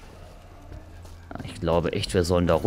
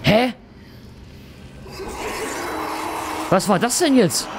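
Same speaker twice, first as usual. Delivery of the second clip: baffled; 8 semitones higher, 4 dB quieter than usual. Real, not acted. Confused by game event.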